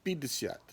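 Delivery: пятьдесят with first vowel as pi, last ya full; reduced first vowel. Shown as a demonstration. Teacher fixing the first syllable, reduced vowel. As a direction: say pi-di-SYAT. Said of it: "instead of пять, is пи".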